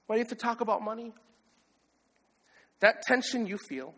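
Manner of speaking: ordinary talk, nothing marked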